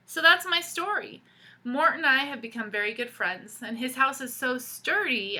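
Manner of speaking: put-on lively read-aloud for kids; storybook reading. A narrator's reading. Teacher reading a picture book to children, animated storytelling. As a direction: tell the story to the class with expression.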